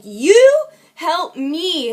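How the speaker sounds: single out You with a long, emphatic push; direct plea. Shown as a demonstration. Teaching how to ask one person for help, You stressed hard. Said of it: "supposed to directly look people in the eye".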